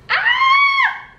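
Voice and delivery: high-pitched loud "ah!"; short high scream. Real, not acted. Vlogger reacting to dried-up flowers, high shriek. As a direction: let out one piercing little shriek.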